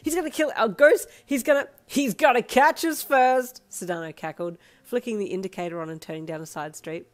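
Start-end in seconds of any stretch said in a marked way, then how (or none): 0.0-3.6 high, loud giddy panic; panicked wail. Acted. Panicking to Sedano about Darby, loud excited wail. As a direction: rant it with thrilled alarm.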